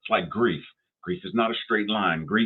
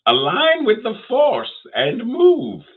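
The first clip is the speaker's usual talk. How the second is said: very high, strained Yoda voice, louder on the commands; Yoda impression; 6 dB louder, slower than usual. Host repeating Yoda's advice more boldly, very high Yoda voice. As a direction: go all in on Yoda, squeaky and emphatic. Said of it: "That's my best Yoda impersonation".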